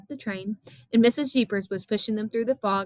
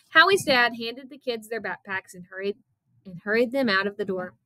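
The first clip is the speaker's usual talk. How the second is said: ordinary delivery, nothing marked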